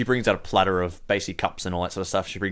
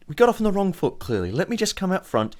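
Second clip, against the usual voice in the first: higher, placating villager voice; bargaining nervously; 6 semitones higher than usual. Acted. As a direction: offer hospitality to save your skin. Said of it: "literally bargaining for her life here".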